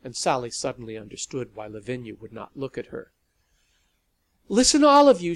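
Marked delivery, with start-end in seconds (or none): 4.5-5.4 much louder, higher snapped call; sharp loud command. A narrator's reading. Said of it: "she snapped".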